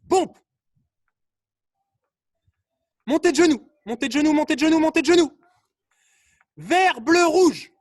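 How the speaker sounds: loud, high drill shouts; called-out commands. Real, not acted. Coach pushes the class from drill to colours, shouted. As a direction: drive the group through each order.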